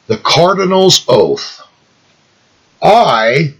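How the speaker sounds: slow, deliberate reading. Shown as a demonstration. Reader lets listeners take in the cardinal's oath, slow and deliberate. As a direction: read slowly, setting each phrase apart. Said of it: "I'm gonna read this really slow".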